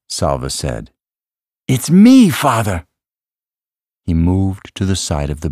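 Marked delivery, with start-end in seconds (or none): 1.7-2.9 high, loud emotional plea; urgent insistence. A narrator's reading. Salva convincing his father it's him, urgent. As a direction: press it home, starting high and loud.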